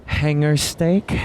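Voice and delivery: a lightly hammed-up fancy server, slow on "hanger steak?"; mildly over-the-top waiter offering steak; dramatic server. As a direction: play it extra and elegant, stretching "hanger steak?". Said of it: "So dramatic, right?".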